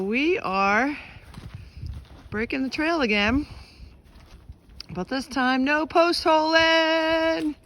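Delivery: slow, loud, labored words; trudging through snow. Real, not acted. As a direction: push each word out mid-stride. Breaking trail in deep snow.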